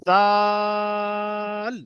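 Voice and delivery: জাল drawn out long, tongue tip touching upper teeth; long drawn zal. Shown as a demonstration. Teacher modelling জাল for students. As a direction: hold জাল for three alif. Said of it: "এরকম হবে".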